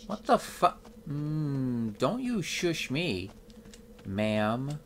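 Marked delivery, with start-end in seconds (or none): none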